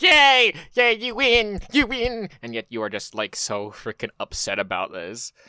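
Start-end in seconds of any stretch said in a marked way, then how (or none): 0.0-2.3 high, loud sarcastic yay; hammed-up fake celebration; mock cheering. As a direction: cheer it like a fake party popper. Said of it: "It's like".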